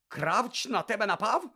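high loud incredulous question; loud outraged disbelief; outraged shout. Acted. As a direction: demand it in disbelief.

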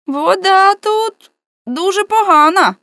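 high, slow, loud call; voice from the abyss. A narrator's reading. Man in the well reporting upward, high drawn-out call. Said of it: "немов з безодні".

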